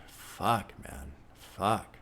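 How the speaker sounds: normal talking voice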